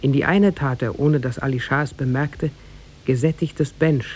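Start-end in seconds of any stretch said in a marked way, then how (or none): none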